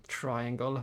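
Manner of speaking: ordinary talk, nothing marked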